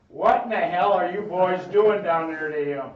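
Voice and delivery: put-on a mother hollering at her boys; angry mom. Mom yelling down the stairs at the boys, angry scold. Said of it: "and then Mom goes".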